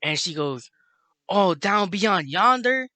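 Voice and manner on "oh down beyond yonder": raised, louder, drawn-out question; quaint old-fashioned stranger. Retold or imitated. Woman asking smokers for directions, mock-quaint and drawn out. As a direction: imitate a stranger's quaint phrasing, slowly.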